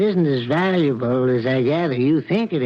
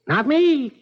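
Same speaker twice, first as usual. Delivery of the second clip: high, pointed not me; indignant snap; sharp retort; 9 semitones higher than usual. Acted. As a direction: throw the blame back hard. Angry retort.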